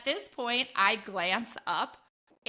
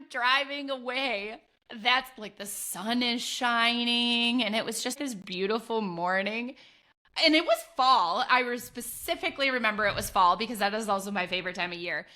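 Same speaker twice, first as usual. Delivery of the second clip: usual speaking voice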